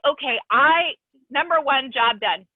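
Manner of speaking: loud, high burst of joy; excited retelling. Retold or imitated. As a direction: announce it like a triumph. Retelling her own joyful reaction.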